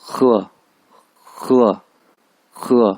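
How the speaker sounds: खुआ opening on a raspy खे; emphatic falling खुआ with throaty kh. Shown as a demonstration. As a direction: scrape the kh, then fall through खुआ. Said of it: "खे पढ़ रहा हूँ यहाँ पे".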